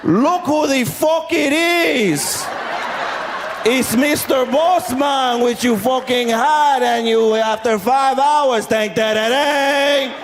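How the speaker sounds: high, drawn-out sarcastic outburst; mad all over again. Retold or imitated. Spanish man spotting the bus people, mad all over again. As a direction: stretch it slowly, high and mock-furious, Spanish accent. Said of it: "he got mad all over again".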